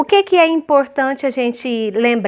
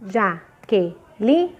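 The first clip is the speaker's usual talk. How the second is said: "Jaqueline" slowly, each syllable on its own; sílabas separadas; 4 semitones lower, 3 dB quieter, much slower than usual. Shown as a demonstration. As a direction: break "Jaqueline" into four syllables with short pauses. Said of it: "já vi criança fazendo assim". ("Jaqueline" dividido em sílabas, devagar)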